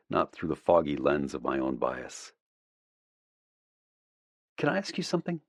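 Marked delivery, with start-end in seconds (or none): 4.6-5.4 female character voice. A narrator's reading. Allie voiced higher by narrator.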